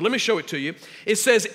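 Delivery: normal talking voice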